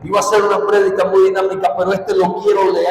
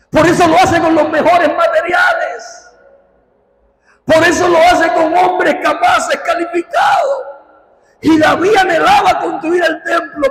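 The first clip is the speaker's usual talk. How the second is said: high, insistent pulpit voice; impassioned preaching; 8 semitones higher, 3 dB louder than usual. Real, not acted. Preacher explaining David's honor toward God, impassioned preaching. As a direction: drive the point home to the congregation.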